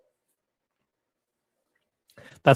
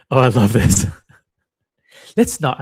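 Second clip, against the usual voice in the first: usual speaking voice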